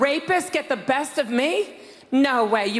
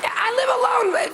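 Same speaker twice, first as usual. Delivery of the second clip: shout; 8 semitones higher, faster than usual. Real, not acted. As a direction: burst out at the audience. Sudden shout at the audience.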